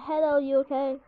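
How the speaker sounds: ordinary delivery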